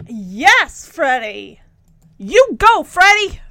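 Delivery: root for him like a fan; cheering him on. Real, not acted. Reviewer cheering Freddie standing up for himself, excited.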